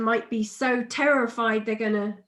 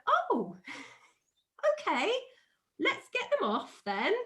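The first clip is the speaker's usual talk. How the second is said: forced calm; 8 semitones higher than usual. Retold or imitated. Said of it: "trying to just be really super chilled about it".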